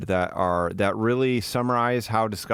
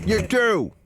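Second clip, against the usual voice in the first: mocking echo; 10 semitones higher, 4 dB louder than usual. Echoing the video host.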